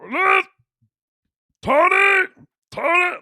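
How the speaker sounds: very high, loud half-tongue voice; garbled, high-pitched holler; Uncle Rick. Retold or imitated. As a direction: yell out as if half your tongue is gone. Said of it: "He would talk like this".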